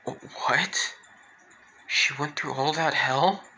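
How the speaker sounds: breathless, whispered disbelief; shocked whisper. Retold or imitated. Toga learning of the girl's past, whispered shock. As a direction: gasp it out in disbelief. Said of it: "Toga's eyes are wide".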